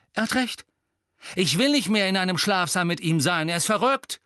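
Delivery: high, agitated outburst; getting heated. A narrator's reading. Seamus refusing to share with Harry, heated. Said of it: "erhitzte sich Seamus".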